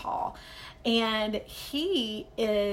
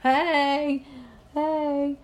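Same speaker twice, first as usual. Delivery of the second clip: repeating a greeting; 5 semitones higher, 6 dB louder than usual. Retold or imitated. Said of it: "I just said".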